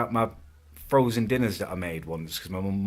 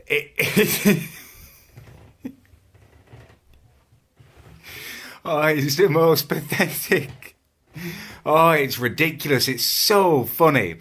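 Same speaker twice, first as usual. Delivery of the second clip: laughing; 8 semitones higher, 6 dB louder than usual. Real, not acted. Laughing at a video about him.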